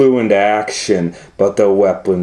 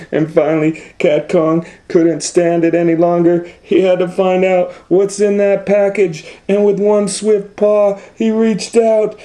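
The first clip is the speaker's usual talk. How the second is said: rising excitement; 10 semitones higher than usual. A narrator's reading. Narrator building up to the cat's fall, climbing higher. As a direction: pitch climbing steadily higher line by line.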